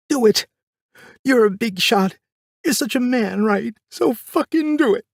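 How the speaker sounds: screaming defiance at the man holding the gun; mother screaming. Retold or imitated. Mother screaming at father to shoot, furious high yell. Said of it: "I remember my mother screaming".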